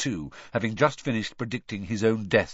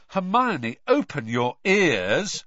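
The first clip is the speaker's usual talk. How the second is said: raised, exasperated appeal, higher pitched; loud; 7 semitones higher, 4 dB louder, slower than usual. A narrator's reading. Ron to Hermione who won't listen, loudly. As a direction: get through to someone not listening. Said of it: "said Ron loudly".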